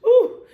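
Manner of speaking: high, loud "Ooh" of delight; excited whoop. Real, not acted. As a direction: squeal with excitement before the word. Preacher's excited exclamation.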